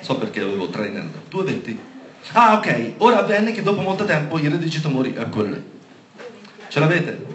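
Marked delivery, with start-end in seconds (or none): 3.0-5.1 fast skim read aloud to check it's the right passage; quick check reading. Real, not acted. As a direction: rattle through the verse quickly. Scorre il versetto per verificarlo. (lettura veloce di verifica)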